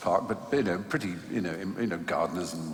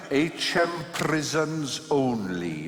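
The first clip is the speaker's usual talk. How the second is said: slowly reading out a sign word by word; 5 semitones higher, much slower than usual. Retold or imitated. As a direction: read the sign aloud haltingly. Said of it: "he would read the signs".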